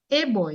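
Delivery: put-on "A boy" starting with the letter-name ay; Portsmouth pronunciation. Shown as a demonstration. Linguist shows what they will say, Portsmouth pronunciation. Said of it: "if I go to Portsmouth".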